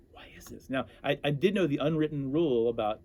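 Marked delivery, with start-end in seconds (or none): 0.1-0.7 breathy unvoiced whisper to himself; wondering why nobody speaks, whispered under his breath; whispered thought. Mascot puzzled by the silent locker room, whispering to himself.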